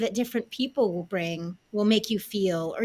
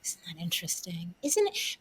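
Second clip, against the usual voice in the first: whispering; 6 dB quieter than usual. Real, not acted. Awed reaction to the story.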